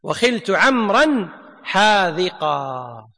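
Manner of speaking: teacher repeating the verse slowly for students; slow, falling recitation. Shown as a demonstration. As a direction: draw out every word and let it fall. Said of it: "المصنف قال".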